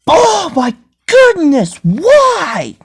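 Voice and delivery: high, loud, slow shocked outburst; shocked cry. Acted. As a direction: gasp it out in horror. Shocked at burned legs.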